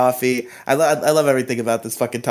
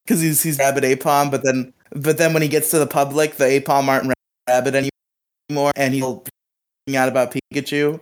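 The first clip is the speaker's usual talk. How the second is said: normal talking voice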